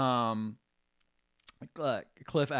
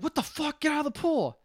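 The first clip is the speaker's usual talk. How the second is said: higher, mock indignant yell; mock outburst; 12 semitones higher than usual. Monk yelling at his brother in the pool, mock outburst. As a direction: act shocked and order him out.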